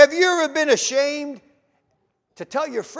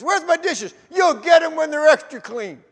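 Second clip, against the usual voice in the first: raised, higher retold yell; yelling back; 5 semitones higher, 5 dB louder than usual. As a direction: shout back at the waiters with pride. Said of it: "I started yelling back at the waiters".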